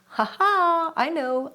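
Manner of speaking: lightly voiced triumphant Queen, pitched high; a high, knowing "ha ha" as an inner Queen; Queen's voice. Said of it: "And my Queen is saying".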